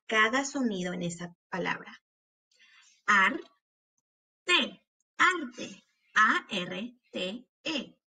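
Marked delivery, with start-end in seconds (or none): 3.1-4.8 "Arte" slowly as ar, long pause, te; broken into syllables. Shown as a demonstration. Teacher helping hear each sound, syllable by syllable. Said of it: "quiero que las quiebres entre sílabas". 6.1-8.0 slow, letter by letter; name each letter with a gap after it; spelling out. Shown as a demonstration. Teacher spelling arte for pupils, letter by letter. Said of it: "cuando estamos deletreando estas palabras".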